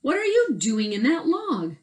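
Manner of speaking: loud demanding question. Acted. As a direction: Cork confronting the possum. Cork questions the possum.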